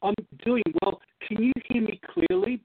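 normal talking voice

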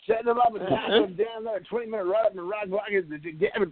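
high, hammed-up radio host voice; over-the-top morning radio patter; cheesy morning DJ voice. Shown as a demonstration. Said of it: "cheesy morning D J radio voice".

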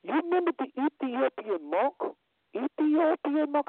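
high, insistent retold question; retold pointed question. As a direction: quote the driver's prompting, pitched well up. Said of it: "He said these words".

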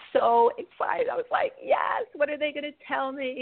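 high, squealing eagerness; excited high squeal. Retold or imitated. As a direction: bounce up with excitement. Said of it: "I'm all excited".

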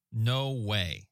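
usual speaking voice

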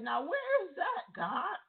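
high cried-out question to God; retold plea. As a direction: cry out, searching. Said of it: "So I said".

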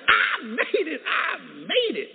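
high, loud triumphant cry, slowing at the end; imitating a man overcome with joy; overjoyed cry. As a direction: cry it out jubilantly, stretching the last words.

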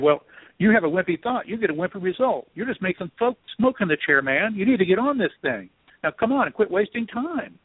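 high-pitched impatient scolding; acting out a friend getting in your face; imitated chewing-out. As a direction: tell them off, pitched well up. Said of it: "chewing me out a little bit".

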